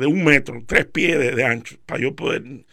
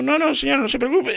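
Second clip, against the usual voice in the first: strained reassurance; 9 semitones higher than usual. Retold or imitated. Squeezing out, reassuring lady.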